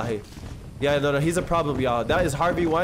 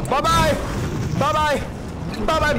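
mock farewell; 7 semitones higher, slower than usual. Taunting as he burns house.

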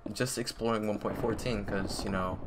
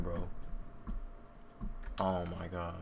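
usual speaking voice

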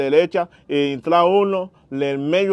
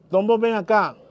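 higher-pitched retold summons; imitated calling over; 6 semitones higher than usual. Woman summoning the cigarette seller, imitated higher-pitched call.

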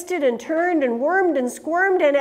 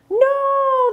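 alarmed cry; 14 semitones higher, 3 dB louder than usual. Acted. Prairie dogs scatter in alarm.

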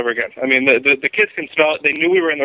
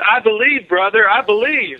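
high, laughing believer voice; imitating a fan; 8 semitones higher, slower than usual. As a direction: gush it like an eager true believer. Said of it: "they sent me the message".

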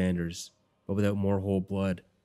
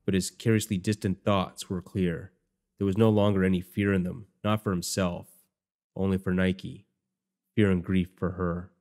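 usual speaking voice

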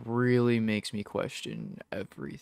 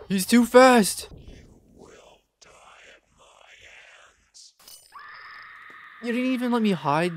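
frustrated yell; 10 semitones higher, 10 dB louder than usual. Real, not acted. Losing the chase.

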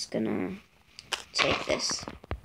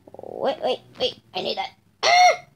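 ordinary delivery

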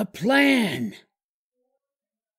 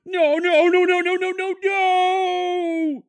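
panicked no's; 9 semitones higher, slower than usual. Acted. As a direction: repeat it in rising panic. Panic over lost phone.

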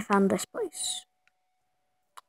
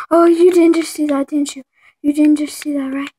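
loud, higher, stunned and excited; shocked disbelief; 4 semitones higher, 8 dB louder than usual. Real, not acted. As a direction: exclaim in disbelief. Shocked at what appeared.

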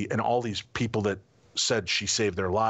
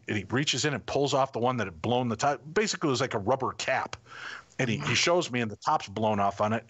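ordinary delivery

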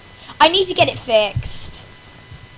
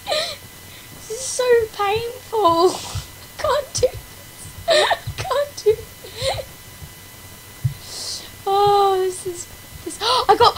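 laugh-crying; 8 semitones higher, 4 dB quieter, slower than usual. Real, not acted.

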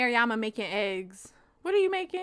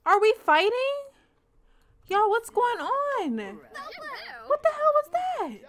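much higher, louder shocked cries; panicked disbelief at a sudden fight; high startled yelps of what's going on; shocked alarm; 11 semitones higher, 4 dB louder, slower than usual. Real, not acted.

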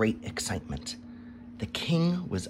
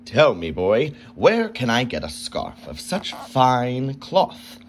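greedy king; 4 semitones higher, 7 dB louder than usual. A narrator's reading. Greedy king questions boy.

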